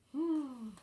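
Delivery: plain reading voice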